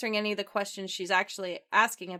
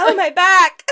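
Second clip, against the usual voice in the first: loud, high cry of sudden back pain; laughing storyteller's fake agony; mock pained yell; 11 semitones higher, 18 dB louder than usual. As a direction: yell it like a hammed-up injury. Acting out golfer hurting back.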